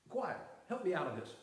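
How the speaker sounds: normal talking voice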